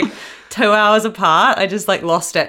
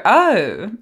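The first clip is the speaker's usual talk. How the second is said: mock-posh British "oh"; posh British accent. Shown as a demonstration. Host showing her borrowed habit, posh British accent. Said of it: "mock how posh they sound".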